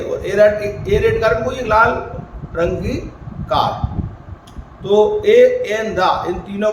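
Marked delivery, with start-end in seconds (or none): none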